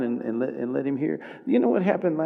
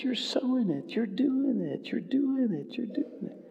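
soft, higher gentle reassurance fading to a whisper; softly encouraging voice of the Lord; tender encouragement; 8 semitones higher, 5 dB quieter than usual. Retold or imitated. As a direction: comfort him gently, trailing off. The Lord's encouraging words.

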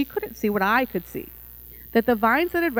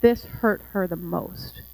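usual speaking voice

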